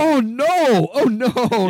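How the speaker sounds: loud, high-pitched drawn-out dismay; mock horror. Viewer watching gory kill, loud mock-horrified wail.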